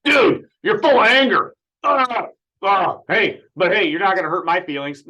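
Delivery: high, loud scolding host impression; mocking Johnny D. Mocking the host lecturing caller Brian, high and loud. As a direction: ham up the preachy radio host. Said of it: "but such Johnny D".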